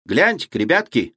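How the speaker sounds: lightly voiced small boy; a hint of a small child's voice. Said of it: "раздался вдруг детский голос Вани".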